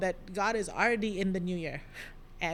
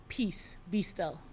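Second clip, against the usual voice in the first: calm command; 5 dB quieter, slower than usual. Acted. Jesus calming the storm, quoted.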